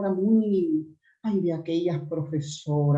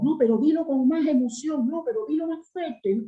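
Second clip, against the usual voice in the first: coaching teacher; 8 semitones higher than usual. Retold or imitated. Teacher coaching her recitation.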